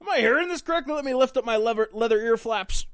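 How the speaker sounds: high, loud put-on voice; loud character voice. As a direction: ham it up loudly. Frank voiced in joke.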